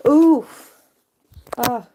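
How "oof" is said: loud groaning oof. Real, not acted. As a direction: react with a pained oof. Reaction to the mess.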